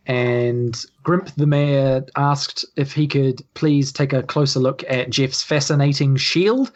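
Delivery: ordinary delivery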